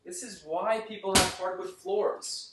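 distant, calling from another room; off-mic in the next room. Real, not acted. Shooting hoop in other room.

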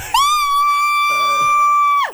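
very high, long piercing shriek; retold freak-out screech. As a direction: imitate a panicked, screechy squeal. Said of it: "that sound you just made".

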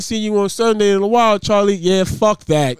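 loud hammed-up retelling. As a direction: boom it out like courtside. Acts church greeting and reply.

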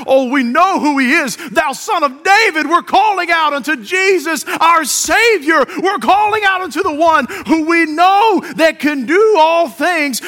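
high, loud, fervent preacher's cry. Acted. Congregation calling out to their Savior, crying out. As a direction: call out with full fervor. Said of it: "we're crying out".

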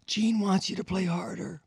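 high, quick messenger voice; relaying the boss. Retold or imitated. Stagehand relaying Gene's demand, high put-on voice. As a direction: pass on the order in a pinched voice. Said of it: "He goes".

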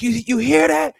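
high-pitched, excited mimicry of a hype man; hyped-up imitation. Retelling a producer's studio brag, hyped. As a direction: imitate a producer hyped on his own work. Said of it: "[name] was hype on that one".

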